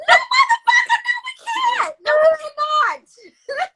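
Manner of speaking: very high, loud laughing shout; screaming refusal. Acted. Woman refusing her cheating ex's friendship, shrieking with laughter.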